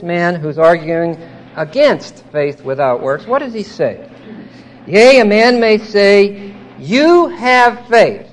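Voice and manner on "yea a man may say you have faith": slow, emphatic reading with YOU hit high. Acted. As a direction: read it out slowly, leaning hard on YOU. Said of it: "Now that's emphatic in the text".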